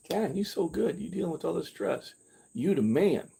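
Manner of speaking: high mock self-pep talk; patting himself on the back, lightly hammed; self-praise. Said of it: "I was complimenting myself".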